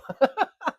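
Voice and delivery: real high laugh bursting out loud; amused laugh at own story; loud burst of laughter. Real, not acted.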